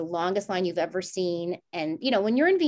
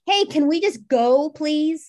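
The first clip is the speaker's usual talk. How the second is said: impatient request; 7 semitones higher, 6 dB louder, slower than usual. Retold or imitated. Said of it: "And I say to the guy".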